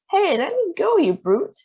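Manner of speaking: high, loud protesting yelp; indignant cry. A narrator's reading. Grandma crying out at the grandpa twisting her arm. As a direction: cry out against a rough grip. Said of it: "cried Grandma Georgina".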